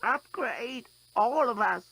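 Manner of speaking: put-on a very old being commanding; ancient croak. A narrator's reading. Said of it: "croaked in an ancient voice".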